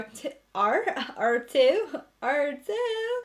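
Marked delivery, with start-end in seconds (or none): 2.2-3.2 "are too" jumps high and bright; high excited. Real, not acted. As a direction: lift "too" up high with glee. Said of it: "I really hope you guys are".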